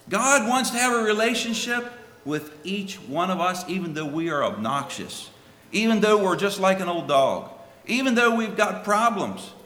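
high, loud impassioned preaching; preaching at full force. Acted. Preacher stirring the congregation, loud impassioned preaching. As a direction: lift the voice and preach it hard.

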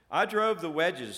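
emphatic, jealous imp's claim; sly, jealous boast. A narrator's reading. Shriveled imp reporting to the prince, sly and jealous. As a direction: boast slyly, with a jealous edge. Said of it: "said jealously, slyly".

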